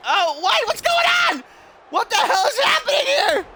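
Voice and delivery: high-pitched disbelieving yell; shocked commentary shout. Real, not acted. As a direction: cry out in shock at the action. Startled commentator at sudden action.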